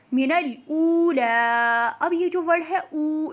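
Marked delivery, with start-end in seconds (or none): 0.1-1.9 slow "मिनल ऊला" with ऊ and final आ held; lengthened vowels. Shown as a demonstration. Teacher models the verse, "ऊला" long drawn out. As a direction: stretch both vowels of "ऊला". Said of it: "इसको हमने क्यों खींचा है?".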